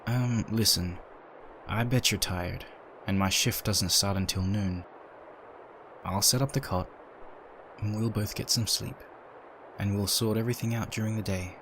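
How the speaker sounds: usual speaking voice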